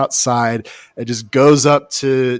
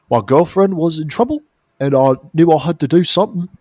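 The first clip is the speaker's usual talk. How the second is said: imitating an Australian accent, a bit higher and louder; mock Australian accent, raised a little; 4 semitones higher, 5 dB louder than usual. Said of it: "all these people have Australian accents".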